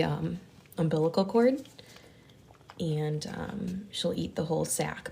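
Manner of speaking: ordinary delivery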